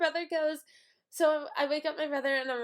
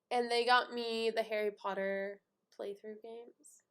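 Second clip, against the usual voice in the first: plain reading voice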